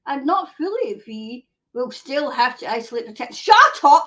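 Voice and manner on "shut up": sudden loud high shout of disbelief; shouted outburst. Real, not acted. Streamer reacting to the isolation news, loud shout.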